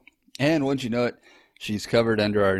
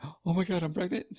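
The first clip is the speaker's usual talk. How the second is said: gasp, then high-pitched astonishment; imitated shock; 9 semitones higher than usual. Husband voicing wife learning she is pregnant, high gasping shock. Wife's shock on finding out retold.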